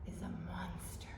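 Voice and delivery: breathy hushed reveal; spooky whisper. Acted. Teacher reading to kids, whispering the scary title.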